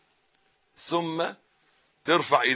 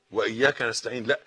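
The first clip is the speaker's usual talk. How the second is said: ordinary talk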